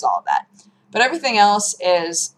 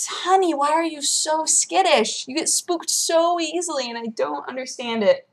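soothing pet talk; 8 semitones higher, 4 dB quieter than usual. Retold or imitated. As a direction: coax the skittish cat gently. Talking to the scared cat.

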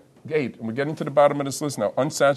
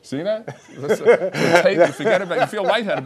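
laughing, pitched up; 6 semitones higher, 9 dB louder than usual. Real, not acted. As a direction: talk through a laugh. Laughing after breathing exercise.

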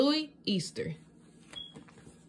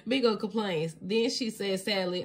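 ordinary delivery, nothing marked

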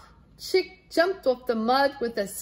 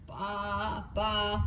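soft low baas; sheep bleat; 3 semitones lower, 4 dB quieter than usual. Acted. Sheep bleating to the horse, soft and low. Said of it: "went the sheep".

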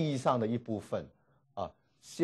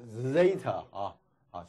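normal talking voice

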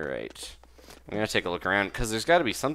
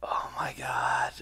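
whispered gasp; slower than usual. Real, not acted. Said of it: "it's a Robo Frog".

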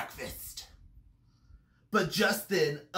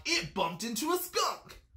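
plain reading voice

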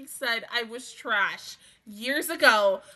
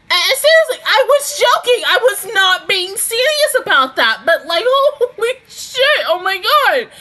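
loud, high-pitched disbelieving exclamation; shocked outburst; 8 semitones higher, 9 dB louder than usual. Real, not acted. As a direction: blurt it out stunned, pitched way up. Shocked that joking prediction came true.

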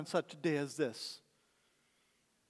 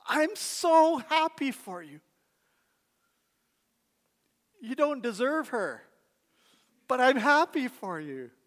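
much higher, louder, slow and beaming; cheering on a friend's engagement; joyful congratulations; 8 semitones higher, 5 dB louder than usual. Pretend. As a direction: celebrate your best friend's news. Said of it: "You say".

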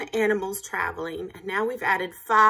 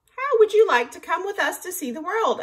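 eager rooster; 9 semitones higher than usual. A narrator's reading. Rooster invites the frogs.

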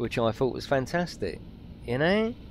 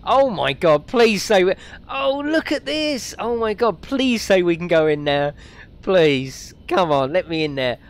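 high, loud begging whine; whiny pleading; 6 semitones higher, 6 dB louder than usual. Acted. Begging the game to let him into the pool, whiny. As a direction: plead like a kid locked out of the pool. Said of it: "that was proper sad face moment".

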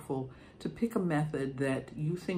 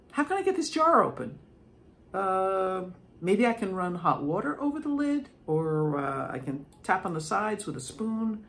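higher and brighter, thinking aloud; cheerful, louder, figuring it out; relieved, upbeat problem solving; 5 semitones higher, 3 dB louder than usual. Shown as a demonstration. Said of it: "You model problem solving".

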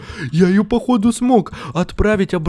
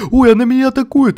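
startled; 6 semitones higher, 5 dB louder than usual. Real, not acted. Monster attacks him in game.

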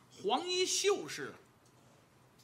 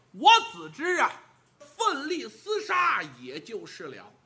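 louder, bellowing, slow and forceful; fierce battle shout; 6 dB louder than usual. Acted. Li Kui shouts to the scholar that he only fights. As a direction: roar it like a warrior charging in.

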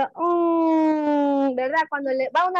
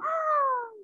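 high, breathy surprised gasp; mock surprise; 9 semitones higher, 5 dB quieter than usual. Shown as a demonstration. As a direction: gasp as if shocked, for the photo. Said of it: "tres, sorprendido".

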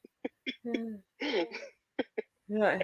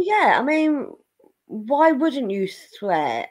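ordinary delivery

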